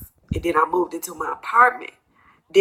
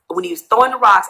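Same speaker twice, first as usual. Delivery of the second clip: ordinary delivery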